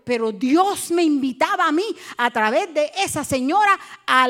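fervent preacher at high volume; full-force preaching. Real, not acted. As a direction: press the invitation on the congregation.

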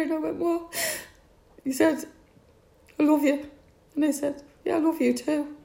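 high, tearful, strained voice near crying; fighting back tears as she relives it; voice breaking. Real, not acted. Mother recalling her father's last words, voice breaking into tears.

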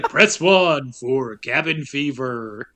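slow, loud announcer voice; phone menu announcer, drawn out; movie phone guy. Retold or imitated. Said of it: "like, the movie phone guy".

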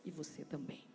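soft, slow, hushed ministering voice; soft worship ministry. Acted. Pastor leading the congregation into worship, hushed and slow. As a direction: draw the church into quiet worship.

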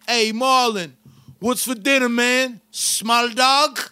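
bad Filipino accent. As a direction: coworker mocking Marlon in bad Filipino accent. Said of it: "he couldn't do a good Filipino accent".

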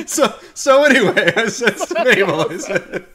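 loud, high, laughing comic anecdote voice; mock chatty storyteller. Joking that the talk just continues, loud mock chatter. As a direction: pick the yarn back up, loud and laughing. Joke of creature resuming a chat.

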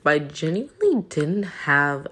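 ordinary delivery

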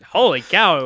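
loud amazed exclamation. Real, not acted. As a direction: react with real astonishment. Reacts to friend's sound effect.

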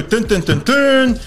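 dramatic dun dun dun, rising at the end; mock sound effect. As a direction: sing a fake fanfare sting. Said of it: "We don't have the effects yet".

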